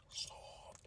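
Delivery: unvoiced taunting whisper; eerie whispered soft. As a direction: hiss the word one last time. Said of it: "they're gonna be like".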